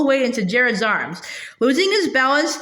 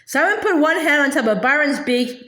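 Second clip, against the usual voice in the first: ordinary delivery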